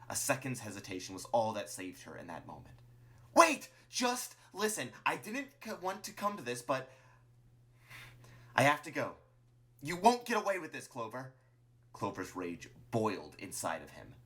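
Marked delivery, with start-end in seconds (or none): 3.4-6.9 very high, loud cry then faltering words; panicked plea. Acted. Martlet pleads as Clover draws the gun, panicked. 8.6-11.3 high, loud character threat; parting warning. Acted. Martlet flees from Clover, warning urgently.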